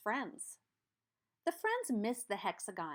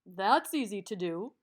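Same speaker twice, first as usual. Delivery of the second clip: louder, distinct shapeshifter voice; 2 semitones lower, 4 dB louder than usual. Acted. Shapeshifter welcomes the quadrilateral back, louder character voice. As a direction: greet the customer as the magical shapeshifter.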